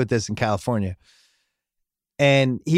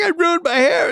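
much higher, whiny imitated sob; mock crying kid; 3 dB louder than usual. Son crying again.

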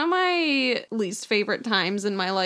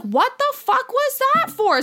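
outraged relative; 4 dB louder than usual. Retold or imitated. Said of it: "they'd be like".

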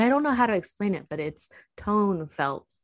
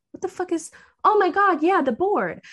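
panicked; 12 semitones higher, 7 dB louder than usual. Retold or imitated. Retold panic at the voice.

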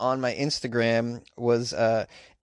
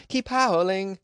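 pitched up, cheering them on; high excited call; 7 semitones higher, much slower than usual. Real, not acted. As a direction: raise the pitch, sing it out. Repeats the urging call, higher.

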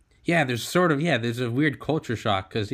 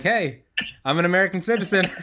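louder, higher, quick self-introduction; pretend pickup line; 6 semitones higher, 4 dB louder than usual. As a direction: play the eager American introducing himself abroad. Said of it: "be like".

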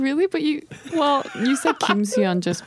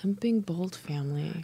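hushed epic motto whisper; Game of Thrones whisper; 9 dB quieter than usual. Acted. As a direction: breathe it like a noble house's words. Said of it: "You sound like you're from Game of Thrones".

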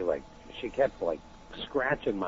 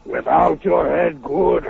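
slow, loud, higher monster voice; Frankenstein monster; 7 semitones higher, 9 dB louder, slower than usual. Acted. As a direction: groan it out like the monster. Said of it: "like, the Frankenstein monster thing for you".

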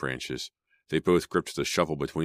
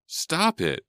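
even higher desperate shriek; shrieking again; 13 semitones higher, 3 dB louder than usual. A narrator's reading. Sydney shrieking again at the fighters, desperate. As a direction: shriek it once more, louder. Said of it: "she shrieked".

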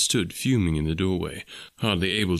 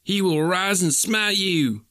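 crying out; 10 semitones higher, slower than usual. A narrator's reading. Young suitor threatening the farmer with divine punishment, much higher crying out. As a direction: call down the Lord's wrath on him. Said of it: "cried young Drebber".

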